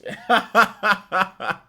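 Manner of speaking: short high laugh; real laugh; burst of laughter. Real, not acted. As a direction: laugh out at the joke. Laughing at own line.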